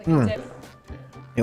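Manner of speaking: ordinary talk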